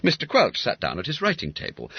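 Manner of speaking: plain reading voice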